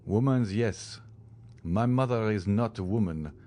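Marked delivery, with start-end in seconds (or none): none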